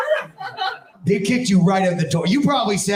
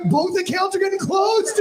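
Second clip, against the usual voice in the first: 1 mock outrage; 10 semitones higher than usual. Comic bank call panic.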